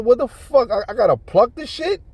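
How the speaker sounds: high, loud mock-incredulous question; scornful question. Woman belittling her man, imitated scornful disbelief. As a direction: imitate her scoffing at him.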